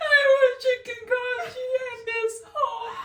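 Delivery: high, loud hammed-up sobbing; mock wailing. Reenacting sick roommate's homesick cry, hammed-up wail. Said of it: "let me reenact this scene".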